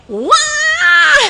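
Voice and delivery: sudden loud high bawling cry; serious child's wail. Retold or imitated. Preacher imitates a child crying in earnest, sudden loud wail. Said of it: "begitu anak nangisnya serius nih".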